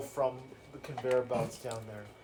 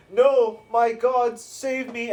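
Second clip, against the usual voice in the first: high, loud plea for rescue; acted panic; 9 semitones higher, 9 dB louder, much slower than usual. Doomed brute begging his god, acted panicked cry. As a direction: cry out slowly to your god in terror. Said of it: "looks wide-eyed and is like".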